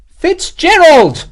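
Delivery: slow, high, loud bellow of the name; irritable shout. A narrator's reading. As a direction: yell for him, patience gone. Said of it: "Morris shouted irritably".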